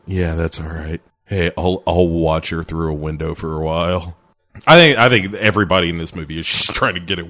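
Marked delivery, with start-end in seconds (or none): 0.1-4.2 low, put-on offhand voice; low mock nonchalance; casual agreement. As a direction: agree coolly, pitched right down. Acting out Sharky's casual agreement.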